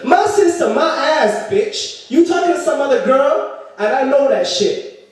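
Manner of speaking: high-pitched mock indignation; scornful girlfriend outrage. Girlfriend not buying the sister excuse, high indignant voice. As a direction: throw his excuse back at him, voice up high.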